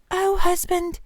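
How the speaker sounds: acted dread, held high without extra volume; dismayed wife. A narrator's reading. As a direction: lament to her husband in dawning horror. Wife realises the terrible mistake.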